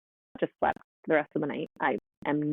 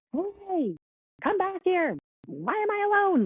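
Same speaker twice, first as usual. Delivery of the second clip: high mock-whine of a baby in protest; put-on high voice, protesting without real hysteria; slower than usual. Babies protesting to parents at bedtime, high mock protest. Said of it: "kind of a protest".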